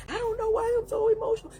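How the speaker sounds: high, loud whiny complaint; hammed-up teary whining; mock emotional wail. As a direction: whine it like an overwrought mock victim. Mocking the emotional listener.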